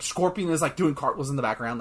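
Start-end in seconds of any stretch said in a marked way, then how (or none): none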